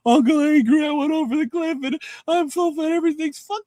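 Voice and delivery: high, whiny, pitiful nephew voice; whiny broken nephew. Retold or imitated. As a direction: whimper like a wrecked kid begging for help.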